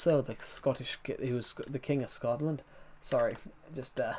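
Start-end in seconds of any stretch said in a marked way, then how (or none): 0.0-2.6 a passing Scottish accent; slipping into Scottish. Real, not acted. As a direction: drift into a light Scottish accent. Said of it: "I started doing accents".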